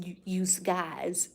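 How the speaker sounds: put-on slow, teasing New York accent. Southerner teasing about the word y'all, mock New York accent. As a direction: drawl it like a mock New Yorker. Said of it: "I love the New York accent".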